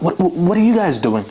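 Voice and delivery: high, alarmed stammered question; a retold startled demand, voice jumping high; startled owner. Car owner catches them at her car.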